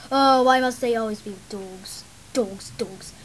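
melodramatic lament. Acted. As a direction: wind down from rage into despairing grumble. Villain laments, winding down.

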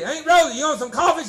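loud coaxing call. Retold or imitated. Uncle offering great aunt coffee, loud coaxing call. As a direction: ask the stroke-struck aunt kindly. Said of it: "he said".

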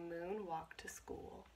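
ordinary talk, nothing marked

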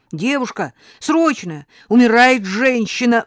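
husband calls ambulance for dying grandmother, urgent. Acted. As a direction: shout it down the phone. Calling ambulance, emergency.

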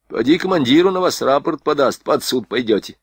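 ordinary delivery, nothing marked

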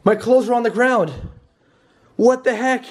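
high frightened shrieks; panic, voice jumping high; shrieking panic. Real, not acted. Finds clothes, bed made.

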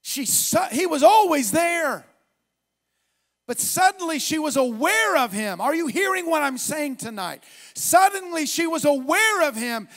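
loud, high, shouted preaching building to song; preaching at full force. Acted. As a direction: preach it out with rising fire.

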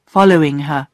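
plain reading voice

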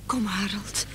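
soft whisper to her husband. Acted. Wife urging her sick husband in the doctor's waiting room, whispered. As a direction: coax him along under your breath.

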